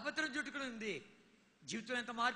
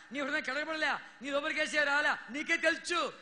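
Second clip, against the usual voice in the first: louder, ringing sermon cadence; preaching at full force; 5 semitones higher, 7 dB louder than usual. Acted. Preacher urging the congregation, full-force preaching. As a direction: preach it hard to the crowd.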